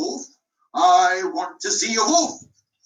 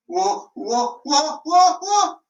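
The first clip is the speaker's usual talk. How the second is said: building cry of wolf; 9 semitones higher, much slower than usual. Acted. Shepherd boy crying wolf again, building to a shout.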